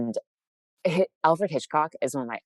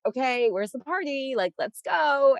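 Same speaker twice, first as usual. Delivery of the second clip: much higher, hyped-up call to go out; party girl; 13 semitones higher than usual. Acted. Playing her party side rallying friends, excited. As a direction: act out an eager party girl, drawing it out. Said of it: "I'm also that girl that's like".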